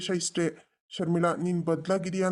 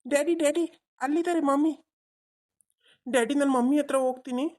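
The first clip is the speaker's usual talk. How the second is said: put-on higher, childlike voice for the son; little boy; 9 semitones higher, 3 dB louder than usual. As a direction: play the small boy tugging at his dad. Adult voicing the child. (ಪುಟ್ಟ ಹುಡುಗನ ಧ್ವನಿ)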